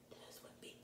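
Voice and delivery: soft unvoiced whisper; whispered aside. Real, not acted. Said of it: "aside whisper".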